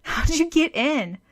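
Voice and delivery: put-on startled girl asking how she got in; surprised question. A narrator's reading.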